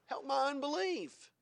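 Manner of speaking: high, slow, drawn-out plea; imitated father's cry for help. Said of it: "the Father cries out".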